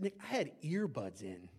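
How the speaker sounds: soft, slow reply of the wife; calm explanation. Retold or imitated. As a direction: defuse the accusation quietly and slowly. Wife calmly deflates his accusation.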